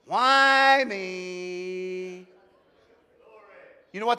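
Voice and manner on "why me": drawn-out whiny moan; whiny Eeyore. Retold or imitated. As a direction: whine it like a gloomy donkey. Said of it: "that sounds like to me? Whiny".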